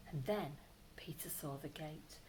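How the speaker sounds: breathy hushed whisper. A narrator's reading. Reader whispering the escape moment to the child. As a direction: whisper it with suspense. Suspenseful reading.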